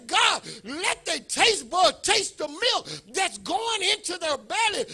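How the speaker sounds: high, loud, slow pleading prayer; father praying over preemies, slow and loud; fervent loud prayer. Real, not acted. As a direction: stretch each word out as you beg God. Prays aloud for babies.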